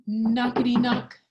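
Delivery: loud knockety knock; knocking sound. Acted. Storyteller voicing Roger's knock, loud sound effect. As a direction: rap it out like knuckles on a wall.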